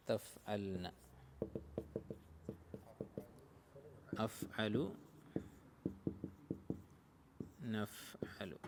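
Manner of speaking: low, soft drill chant, last form almost whispered; conjugation recitation. Shown as a demonstration. Teacher recites conjugation table.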